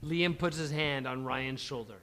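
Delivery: usual speaking voice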